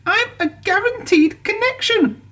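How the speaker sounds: high, disappointed engine voice; unhappy. A narrator's reading. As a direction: sigh it out, let down. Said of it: "said Percy unhappily".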